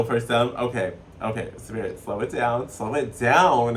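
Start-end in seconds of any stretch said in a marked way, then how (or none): 2.0-3.8 slow, louder, stretched-out "down"; emphatic slow-motion request; drawn-out plea. Real, not acted. Tells Spirit to slow down.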